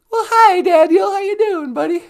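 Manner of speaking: high, loud cooing greeting; sing-song high welcome; baby talk greeting. Acted. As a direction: coo at him like a pet.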